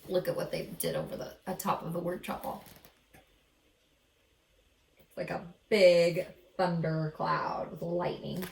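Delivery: ordinary delivery, nothing marked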